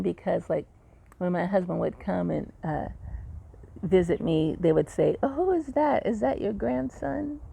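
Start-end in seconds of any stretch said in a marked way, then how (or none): 5.2-7.4 nosy staff question. Retold or imitated. Staff mistaking husband for grandson.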